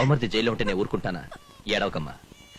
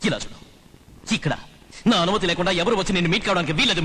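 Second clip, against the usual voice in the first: ordinary talk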